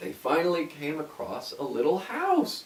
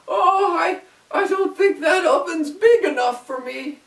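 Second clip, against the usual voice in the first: put-on high, loud frightened girl; scared girl; 14 semitones higher, 7 dB louder than usual. A narrator's reading. Gretel fears the oven, high and frightened. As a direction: stammer in fear. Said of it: "She said".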